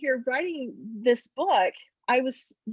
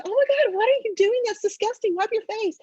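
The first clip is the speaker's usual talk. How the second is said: put-on much higher, louder disgusted teenage girl; 12 semitones higher, 4 dB louder than usual. Abby scolding her slobbery little sister, disgusted. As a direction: recoil at the slobber. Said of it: "She goes".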